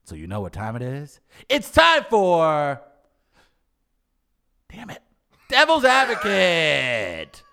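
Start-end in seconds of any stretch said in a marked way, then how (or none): 1.5-7.4 slow, loud announcer. Acted. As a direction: boom out the segment title. Announcer segment intro.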